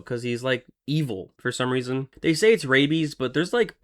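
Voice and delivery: ordinary delivery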